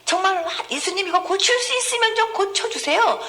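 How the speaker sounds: a hint of an anxious father begging; doubtful father pleading. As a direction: beg like a father unsure it can work. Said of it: "이렇게 얘기했더니".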